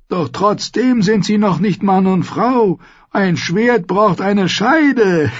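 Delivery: put-on high, loud old man with a leering tone; leering old lord. A narrator's reading. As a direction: relish every crude word like a lecherous old host. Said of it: "rief Lord Waldorop zu".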